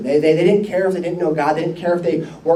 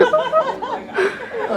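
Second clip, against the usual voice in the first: laughing; 4 dB quieter than usual. Real, not acted. Laughing at daughter's answer.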